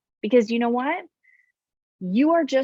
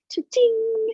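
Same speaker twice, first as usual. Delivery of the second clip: cha-ching cash sound; 13 semitones higher than usual. Acted.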